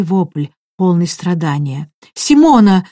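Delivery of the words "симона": louder, higher anguished call; shouting. A narrator's reading. Raoul calling to the screaming medium, alarmed shout. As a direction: cry out her name in alarm. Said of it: "закричал Рауль".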